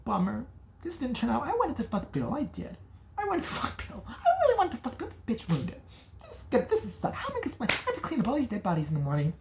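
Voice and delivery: high, soft whiny sulk; mock pouting teen. Alice sulking on the beach, high and whiny. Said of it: "She's like".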